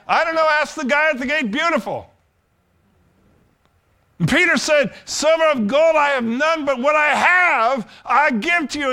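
high, loud, impassioned preacher's shout; full-force preaching. Real, not acted. Preacher proclaiming healing in the name to the congregation, full-force shouted preaching. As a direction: build to a ringing, shouted sermon climax.

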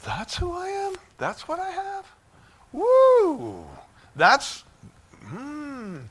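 high, wondering delight that bursts into a woo; like finding a treasure in yourself; high-pitched marveling then a whoop; amazed discovery. Pretend. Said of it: "you say".

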